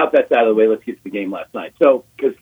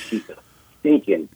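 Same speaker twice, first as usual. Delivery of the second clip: ordinary talk, nothing marked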